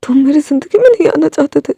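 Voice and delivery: ordinary delivery, nothing marked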